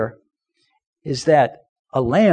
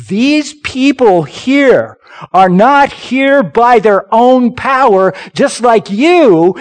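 slow, higher, louder sermon push; emphatic preaching; 6 semitones higher, 5 dB louder, slower than usual. Acted. Preacher pressing the point home to his church. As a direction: lean on every word.

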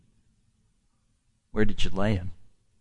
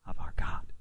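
whisper; 12 dB quieter, faster than usual. Real, not acted. Hushed ending of a sermon line.

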